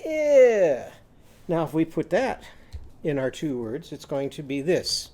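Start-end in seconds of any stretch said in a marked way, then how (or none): 0.0-0.9 loud high Eh like an itchy shrug. Shown as a demonstration. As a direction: squeal Eh with itchy shoulders. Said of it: "Cross your arms like this and go".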